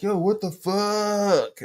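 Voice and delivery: high, drawn-out comic outcry; mock shocked cry. Man whose hand the robot chopped off, mock shocked. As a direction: stretch it out in exaggerated dismay.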